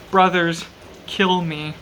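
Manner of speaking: ordinary delivery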